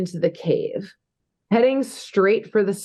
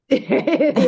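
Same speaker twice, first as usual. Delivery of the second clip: laughing; 6 semitones higher than usual. Real, not acted. Echoes partner's joke, laughing.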